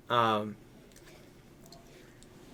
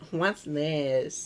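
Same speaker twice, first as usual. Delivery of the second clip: put-on snobby teen voice; 3 semitones higher than usual. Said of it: "that was still in my phase of".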